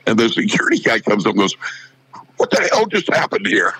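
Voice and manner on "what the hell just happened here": higher-pitched mock disbelief; retold astonishment. Security guard demands to know what just happened, retold astonishment. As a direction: act out a baffled onlooker.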